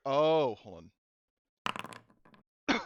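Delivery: ordinary delivery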